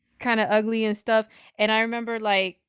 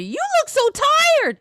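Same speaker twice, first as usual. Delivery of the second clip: high, louder, slow fake pity; mock concerned stranger; 8 dB louder, slower than usual. People walking up to her.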